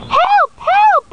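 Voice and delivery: damsel crying for help. Acted. Stuck girl calling for rescue, very high and shrill. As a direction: shriek for help like a silent-film damsel.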